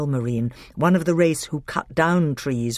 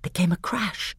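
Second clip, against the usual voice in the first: ordinary delivery, nothing marked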